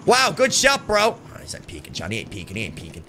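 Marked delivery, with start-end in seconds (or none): 1.3-3.0 whispered, much softer; unvoiced whisper to teammate Johnny. Real, not acted. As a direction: breathe it without voice so he won't hear.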